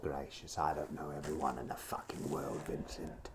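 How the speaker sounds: low, soft, exasperated British voice; imitation British butler, hushed and put out; put-on British gent. Pestered about who an actor is, low mock-British exasperation.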